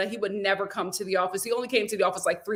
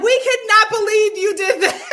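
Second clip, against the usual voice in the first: laughing imitation; 11 semitones higher, 7 dB louder than usual. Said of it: "They were like".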